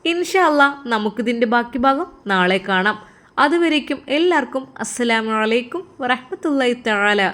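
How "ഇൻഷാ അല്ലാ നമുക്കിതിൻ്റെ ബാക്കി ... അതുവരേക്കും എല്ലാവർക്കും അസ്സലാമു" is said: lively sign-off. Real, not acted. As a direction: lift it up to close the episode. Narrator's outro to listeners.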